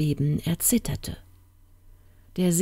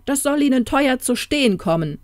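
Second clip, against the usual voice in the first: raised-pitch, wrathful royal threat; storybook king's anger; angry threat; 7 semitones higher, 4 dB louder than usual. Acted. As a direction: threaten like an enraged old king. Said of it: "blitzten seine Augen vor Zorn".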